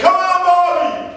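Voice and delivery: high, stretched-out cheer; retold yelling. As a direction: imitate a fan yelling for a wrestler. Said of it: "He was yelling".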